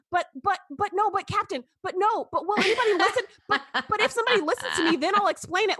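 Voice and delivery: pleading with the captain through laughter; mock whiny Wesley. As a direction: play a flustered teen nobody listens to. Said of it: "Don't spend ten minutes going".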